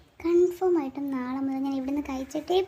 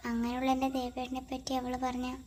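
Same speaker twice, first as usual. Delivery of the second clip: much softer, hushed voice; 10 dB quieter than usual. Acted. A girl wondering about a late call from Ram, hushed and subdued.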